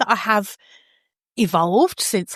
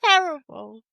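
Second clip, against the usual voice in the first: mock posh English; 7 semitones higher than usual. Mock verdict after impression.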